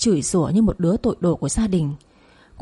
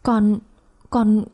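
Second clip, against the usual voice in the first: slow, halting stammer; speechless stammer; 2 semitones higher, slower than usual. A narrator's reading. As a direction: stumble on the words in understated shock. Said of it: "ngọng cả miệng, không nói được thành lời". (lắp bắp, nghẹn lời)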